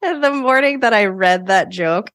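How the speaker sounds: high, loosening out of a laugh. Real, not acted. Carrying on after telling her stroganoff joke, laughing. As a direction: talk through leftover giggles.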